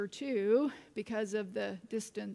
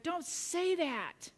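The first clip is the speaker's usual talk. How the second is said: high, slow mock yell without volume; 6 semitones higher, slower than usual. Son objecting to mother's love, mock-yelling slowly. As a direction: drag out a child's protest, pitched up. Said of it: "Jake yelled back".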